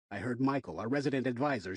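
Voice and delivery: ordinary delivery, nothing marked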